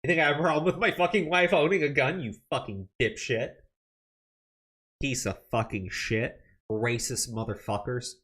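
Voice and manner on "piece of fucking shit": ordinary talk, nothing marked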